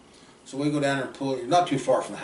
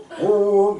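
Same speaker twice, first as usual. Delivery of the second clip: high, loud mock hound howl; bay like a coon dog off in the woods; 9 semitones higher, 9 dB louder than usual. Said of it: "we hear that old coon hound".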